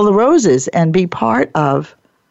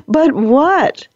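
emphatic; 7 semitones higher than usual. Shown as a demonstration. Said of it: "That's the seminal question".

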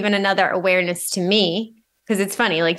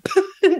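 high laugh; 12 semitones higher than usual. Real, not acted. Laugh at own irony.

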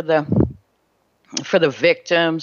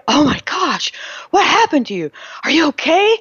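worried family; 10 semitones higher than usual. Retold or imitated. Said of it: "And they're like".

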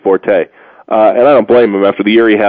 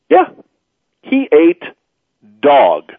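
ordinary delivery

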